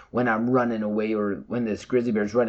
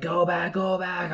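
retold scream; 7 semitones higher, 3 dB quieter, slower than usual. Hiker calls to distant team members to turn back, mock yelling. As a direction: imitate screaming a warning, held back in volume. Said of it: "I'm, like, screaming, I'm yelling".

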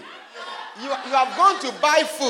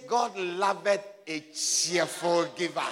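quiet scripture quote; 6 semitones lower, 7 dB quieter, slower than usual. Acted. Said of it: "He says".

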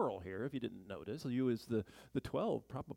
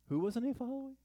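pitched way up in mock puzzlement; rhetorical question; 12 semitones higher than usual. Real, not acted. Preacher teasing the congregation with a high question. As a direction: ask it high, teasing the listeners. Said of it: "rhetorical question to congregation".

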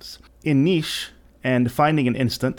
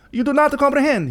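Fortunato voice; 14 semitones higher than usual. A narrator's reading. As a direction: pitch up into the tipsy Fortunato. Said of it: "he said".

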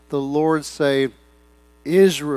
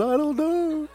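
laughing, sheepish; 13 semitones higher, slower than usual. Retold or imitated. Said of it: "and I said".

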